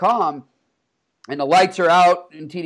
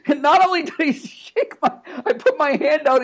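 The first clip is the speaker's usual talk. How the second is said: laughing while talking; 9 semitones higher than usual. Real, not acted. Laughing while retelling the hug.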